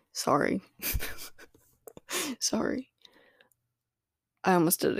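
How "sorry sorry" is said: laughing. Real, not acted. Laughing at own slip-up.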